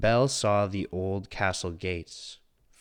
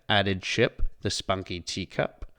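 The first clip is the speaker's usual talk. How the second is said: ordinary delivery, nothing marked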